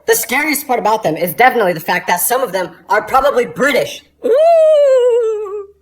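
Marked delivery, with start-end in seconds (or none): 4.2-5.7 high, long spooky ghost wail; ghost moan. Acted.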